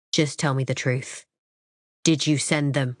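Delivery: relentless. A narrator's reading. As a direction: press firmly for the truth. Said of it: "he continues relentlessly".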